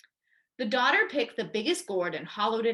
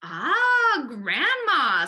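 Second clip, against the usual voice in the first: delighted fox; 5 semitones higher, 7 dB louder, much slower than usual. A narrator's reading. Fox greets caught Grandma.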